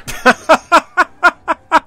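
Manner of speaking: loud high cackle at the absurdity; high mocking laugh. Real, not acted. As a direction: burst out laughing in disbelief. Laughing at the bad logic.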